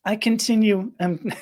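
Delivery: a little breathless, breaking into a laugh; flustered, with a touch of short breath; breathing shallowly. Real, not acted. Breathing shallowly just thinking about this, flustered. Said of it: "breathing shallowly now".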